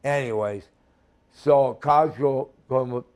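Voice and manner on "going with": usual speaking voice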